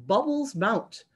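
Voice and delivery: plain reading voice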